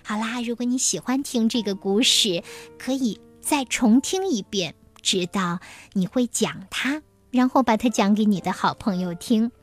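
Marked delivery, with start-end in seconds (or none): none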